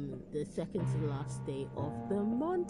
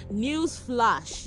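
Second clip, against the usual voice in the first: announcer voice; 6 semitones higher, 8 dB louder than usual. Acted. Mock announcement to listeners.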